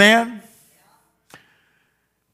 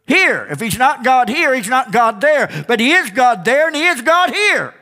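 higher emphatic preacher; preaching; 7 semitones higher than usual. Acted. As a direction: hammer it home. Preaching peak.

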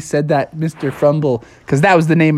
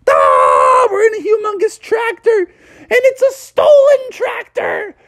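very high cartoon wail; mock hysterical shouting; panicked shriek. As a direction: scream it out in hammed-up horror. Both realise they stole a tractor.

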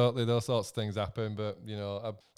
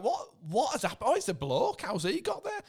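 mock surprise; 8 semitones higher than usual. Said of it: "You're like".

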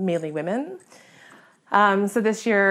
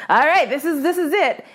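higher, louder psyched-up declaration; retold confidence; 6 semitones higher, 4 dB louder than usual. Hunter walking up to her bird, playfully confident. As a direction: pump yourself up. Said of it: "I'm walking in pretty confidently".

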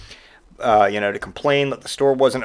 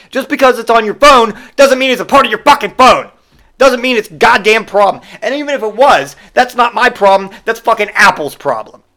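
higher and louder, furious venting; angry rant; 7 semitones higher, 6 dB louder than usual. Pretend. Clerk vents at the customer about his phone, angry and loud. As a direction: unload on the customer, swearing.

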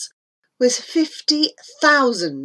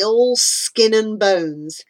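ordinary delivery

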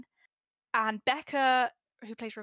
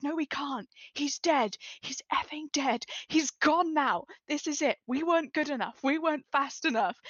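higher, mildly louder grief, a replayed breakdown; retold sobbing outburst; 5 semitones higher, 3 dB louder than usual. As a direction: relive the despair without real tears.

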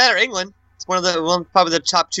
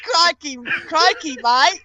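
high hammed-up Australian crikey; crikey like a cartoon Aussie; Australian accent; 8 semitones higher, slower than usual. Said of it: "try to do an Australian".

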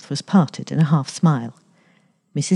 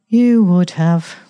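louder, drawn-out cold retort; 3 semitones higher, 6 dB louder, much slower than usual. A narrator's reading. Daughter answering mother about old valentines, coldly. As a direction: stretch the words out slowly and coldly. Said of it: "Nell said coldly".

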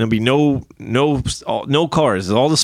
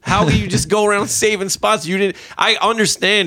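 indignant rant; 7 semitones higher than usual. Real, not acted. I was furious.

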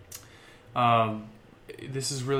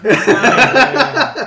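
laughing; 13 semitones higher, 16 dB louder than usual. Real, not acted. Guest laughs at tease.

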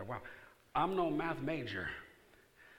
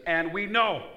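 high, loud proclamation; loud preaching, and we know; proclaiming; 7 semitones higher, 13 dB louder, slower than usual. Acted. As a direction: declare it to the crowd. Preacher exclaims.

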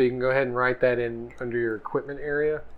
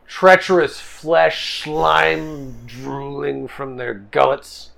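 slow, heavy scene setting; ominous narration; 2 semitones higher, 6 dB louder, much slower than usual. A narrator's reading. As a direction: drag it out like a horror host. GM describing the goblins.

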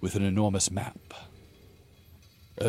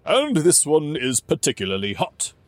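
jovial king voice; 6 semitones higher, 6 dB louder than usual. Acted. King pointing out mustards.

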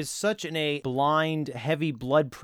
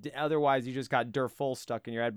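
normal talking voice

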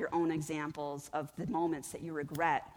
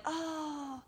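higher, mopey mock self-pity; self-pitying whine; 6 semitones higher than usual. Gym quitter moaning about laziness, higher mock self-pity. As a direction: sigh out the excuses. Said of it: "we're like".

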